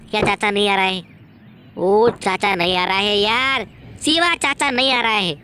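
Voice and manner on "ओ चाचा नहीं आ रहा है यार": ordinary talk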